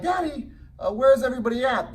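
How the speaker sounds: lightly voiced puzzled six-year-old; son's voice. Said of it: "and he's like".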